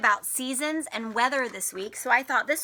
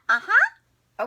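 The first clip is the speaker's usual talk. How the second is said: high, loud puppet uh-huh; puppet agreeing; 9 semitones higher, 7 dB louder than usual. Acted. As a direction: chirp yes as the puppet.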